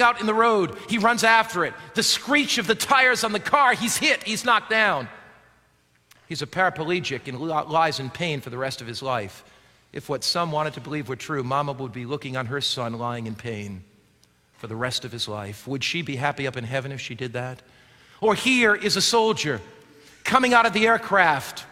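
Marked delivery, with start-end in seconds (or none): 6.3-17.6 low, soft and somber; hushed, grave. A narrator's reading. Preacher asking if Mama could watch her son suffer, hushed and grave. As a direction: drop right down for the tragic turn.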